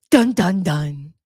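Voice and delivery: sung suspense sting, falling at the end; dramatic dun dun dun. Pretend. Host voices a suspense sting as the doorbell rings. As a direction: hum it like a cheesy TV cliffhanger.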